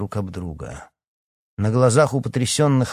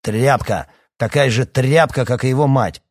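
loud, scornful outburst; contemptuous insult; 3 semitones higher, 6 dB louder than usual. A narrator's reading. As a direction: spit out the insult loudly. Father insults son and wife.

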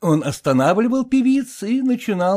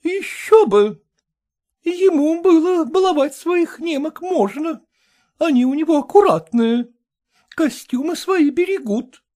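high, brisk society matron; brassy lady voice; 11 semitones higher than usual. A narrator's reading. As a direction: play the bossy choir mistress. Kolkina voiced by reader.

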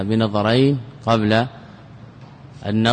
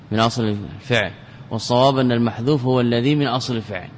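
ordinary talk

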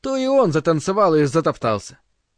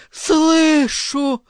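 much higher ecstatic cry; 10 semitones higher than usual. Acted. Morgana keeps crying out to the circle. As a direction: cry it out in rapture. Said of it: "закричала она".

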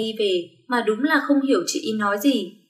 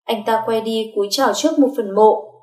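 usual speaking voice

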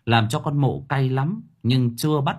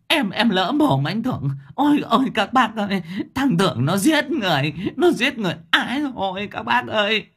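much higher frantic begging and crying for help; pleading wail; 10 semitones higher than usual. Acted. Đốp, beaten, begs Thưởng and cries to neighbours, high wail. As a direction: beg for mercy then scream for the neighbours. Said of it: "quay như trong chóng".

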